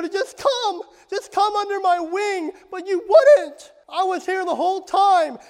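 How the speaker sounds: loud, high, pleading cry; anguished lament. Acted. Preacher as Jesus grieving over his congregation, anguished lament. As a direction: lament at full voice, pitch held high. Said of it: "weep like he did over Jerusalem and say".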